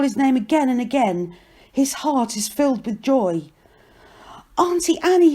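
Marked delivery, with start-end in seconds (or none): 4.6-5.3 high, loud, joyful call; shouting. A narrator's reading. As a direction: shout happily across a crowd. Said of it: "he shouts".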